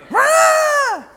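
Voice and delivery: high, loud mock child's cry of alarm; child's yell. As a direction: yell out like a startled little boy stuck in a chair. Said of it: "and I hear".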